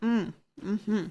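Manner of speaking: talking while chewing chips; crunching chips. Real, not acted. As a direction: mouth full of crunchy snack. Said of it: "Sorry for the crunching".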